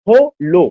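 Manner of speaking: हो लो in two separate beats; split into syllables. Shown as a demonstration. Teacher counting syllables, हो and लो said apart. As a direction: break हो from लो with a gap. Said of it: "syllable दो है".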